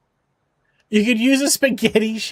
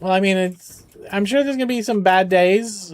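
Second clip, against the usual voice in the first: usual speaking voice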